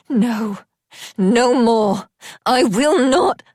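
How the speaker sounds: desperate friend refusing his death; frantic refusal. A narrator's reading. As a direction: refuse with everything.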